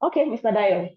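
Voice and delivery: normal talking voice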